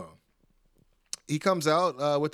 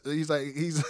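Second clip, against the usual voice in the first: usual speaking voice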